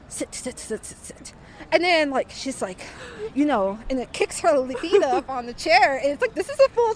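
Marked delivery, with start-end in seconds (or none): 0.1-1.3 whispered, much softer repeated sit; hushed urging. Retold or imitated. Old woman on the train urging her to sit, whispered. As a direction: hiss it under your breath, insistent.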